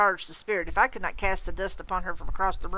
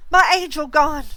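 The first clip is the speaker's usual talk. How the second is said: high, loud anguished scream; 9 semitones higher, 12 dB louder than usual. Acted. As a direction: wail it out at full voice. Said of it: "she screamed".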